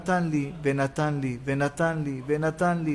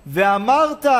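loud, rising call of one word; loud proclamation; 3 semitones higher, 7 dB louder, slower than usual. Shown as a demonstration. Preacher showing how firstfruits declaration is said, loud proclamation. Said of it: "אומרים בביקורים בקול רם".

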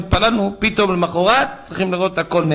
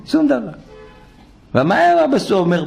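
usual speaking voice

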